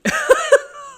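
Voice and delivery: very high, very loud burst of laughter; shrieking laugh. Real, not acted. Solver shrieking with laughter at the puzzle.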